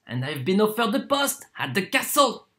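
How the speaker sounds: ordinary delivery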